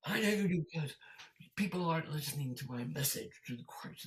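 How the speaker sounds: high-pitched angry rant; hammed-up indignant outburst; sudden mock anger. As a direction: imitate a preacher abruptly losing his temper. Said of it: "a switch".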